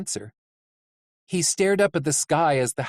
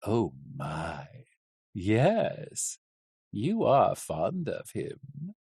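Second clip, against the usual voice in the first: taunting coachman; 4 semitones lower, slower than usual. Acted. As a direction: start showy, drop to a knowing low purr. Coachman taunts, then gloats quietly.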